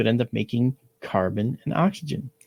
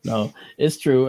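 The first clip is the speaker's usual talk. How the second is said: normal talking voice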